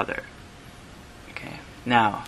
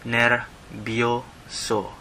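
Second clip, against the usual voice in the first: slow pronunciation; 3 dB quieter, much slower than usual. Shown as a demonstration. Teacher pronouncing the song title slowly. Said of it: "the name of the song".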